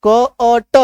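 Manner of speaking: क अ ट broken into three separate sounds; teacher isolating each sound in क अ ट. Shown as a demonstration. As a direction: segment it slowly as "k, uh, t": क अ ट. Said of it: "अ का sound".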